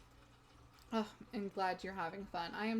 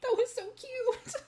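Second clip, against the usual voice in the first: high, loud squeal over something cute; tearful-happy squeal, much higher and louder; overwhelmed squeal; 13 semitones higher, 6 dB louder than usual. Real, not acted. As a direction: gush like it's almost too cute to bear. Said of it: "I'm definitely gonna cry now".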